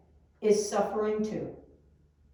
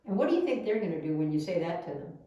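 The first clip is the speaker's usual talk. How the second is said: ordinary talk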